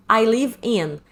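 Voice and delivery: normal talking voice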